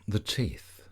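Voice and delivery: soothing Yoga Nidra guidance, unhurried and even; guided meditation. Acted. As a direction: lead the listener gently into rest, one body part at a time.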